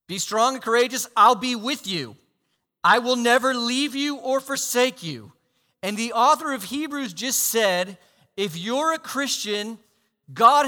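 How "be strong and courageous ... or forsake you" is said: higher, louder proclamation; God declaring strength to Joshua. Acted. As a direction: boom out the promise with power. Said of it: "God says to Joshua".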